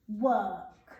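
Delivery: ordinary talk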